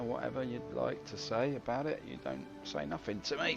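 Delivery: ordinary talk, nothing marked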